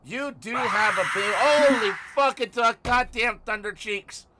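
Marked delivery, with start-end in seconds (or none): 1.4-4.3 high, loud startled swearing; startled outburst. Real, not acted. Streamer jolted by Thunder Cheeks screaming, startled yell.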